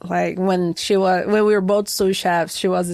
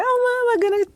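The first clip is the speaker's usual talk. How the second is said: excited self; 13 semitones higher, faster than usual. Retold or imitated. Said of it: "I said".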